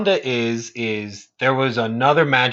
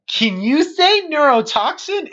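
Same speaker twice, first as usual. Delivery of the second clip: very high, slow presenter question; exaggerated kids' show lilt, rising at the end; Dora; 13 semitones higher, 3 dB louder than usual. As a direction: prompt an unseen audience in a cartoon-host voice. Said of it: "looking off into the distance like".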